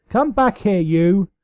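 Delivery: high, loud mock-angry call; a hammed-up chase cry, laughter underneath; playful shout. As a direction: yell after the fleeing sister in fun. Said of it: "Celestia snickered".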